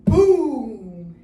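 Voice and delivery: loud booming thunder. Shown as a demonstration. Teacher leads kids in loud thunder booms. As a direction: drop a big boom with the arm. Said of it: "let's start with our thunder".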